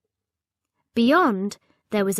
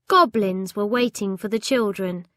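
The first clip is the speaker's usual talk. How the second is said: plain reading voice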